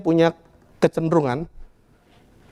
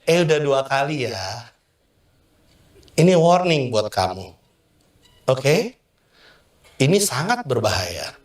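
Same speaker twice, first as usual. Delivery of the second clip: a hint of a stern parent, slow and lower; lightly voiced parent giving a slow, firm warning; 5 semitones lower, slower than usual. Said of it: "Kamu akan bilang sama dia". (orang tua menegur anak dengan pelan dan tegas)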